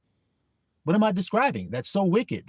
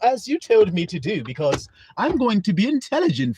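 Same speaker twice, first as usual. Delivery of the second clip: put-on higher, prim, overly polite little boy; sarcastic good-little-boy voice; mock obedient child; 4 semitones higher than usual. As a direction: play the impossibly well-behaved son.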